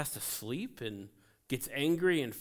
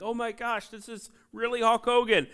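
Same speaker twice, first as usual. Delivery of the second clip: higher, starstruck gasp of disbelief; excited disbelief; 7 semitones higher than usual. Retold or imitated. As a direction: retell it like you can't believe who's on the phone. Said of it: "And I'm like".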